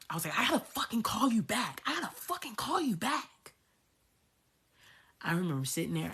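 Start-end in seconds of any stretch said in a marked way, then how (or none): 0.4-3.5 high-pitched re-enacted meltdown; losing it. On phone with best friend, losing it. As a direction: pitch climbing as you act out falling apart. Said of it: "Like, I lost it".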